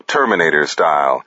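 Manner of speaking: ordinary delivery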